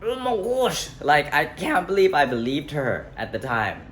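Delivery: exclaim, amazed at your own gullibility; self-directed disbelief. Real, not acted. Man looking back on believing her, high incredulous exclaiming.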